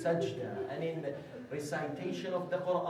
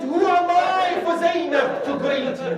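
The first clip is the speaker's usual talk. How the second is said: anguished cry; 12 semitones higher, 12 dB louder than usual. Acted. Habib overwhelmed, slapping his face.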